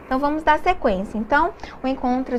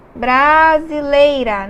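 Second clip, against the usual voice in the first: Brasileira said slowly and louder, falling; slow model word; 5 dB louder, much slower than usual. Shown as a demonstration. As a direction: spell out Brasileira slowly for the class. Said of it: "Nós temos aqui duas vogais". (Brasileira dita devagar e mais alto)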